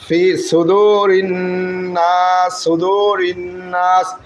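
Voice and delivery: slow সুদুরিন নাস, doubled n hummed; held ghunna. Shown as a demonstration. Teacher shows the compulsory ghunna, humming into নাস. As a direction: hold the nasal n between সুদুরিন and নাস. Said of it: "এক আলিফ গুণনা করা ওয়াজিব".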